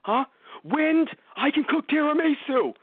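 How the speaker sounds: high, fast, frantic yell; screaming at the wind. Retold or imitated. Terry alone outside, screaming his boast at the wind. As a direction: shout it at the sky in fast defiance. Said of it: "Just screaming at the wind".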